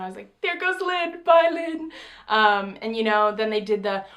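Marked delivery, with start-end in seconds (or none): 0.4-1.9 high-pitched sing-out, retold; excited fan call. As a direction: call out to a star you spotted. Retold fan spotting Lin on stage.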